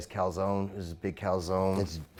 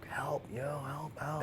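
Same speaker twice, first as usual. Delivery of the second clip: whisper; 5 semitones higher, 9 dB quieter, slower than usual. Retold or imitated. Hurt child pleading to his father.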